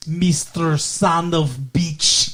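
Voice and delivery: slow, drawn-out mock Russian accent; comically overdone Russian accent; bad Russian accent. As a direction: imitate a hammy Russian accent, stretching every word. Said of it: "That's a horrible Russian accent".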